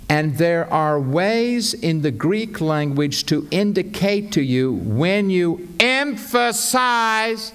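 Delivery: ordinary talk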